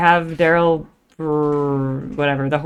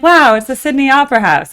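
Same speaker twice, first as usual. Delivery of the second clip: loud, high tourist "wow"; play-acted amazement; 8 semitones higher, 7 dB louder than usual. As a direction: pretend to be wowed, voice lifted. Acts out future excited live reaction.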